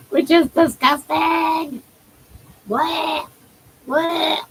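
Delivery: high, drawn-out squeal of disgust; grossed out by a slobbery lick; playful disgust. Real, not acted. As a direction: recoil with a high bleh. Revolted by the pet's tongue.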